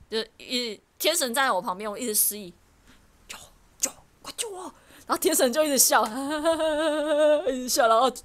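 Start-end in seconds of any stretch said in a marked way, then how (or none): 3.3-4.7 soft, higher "help me"; a hushed inner cry for rescue; half-joking silent call for help to a friend; mock plea for help.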